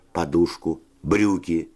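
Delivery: ordinary delivery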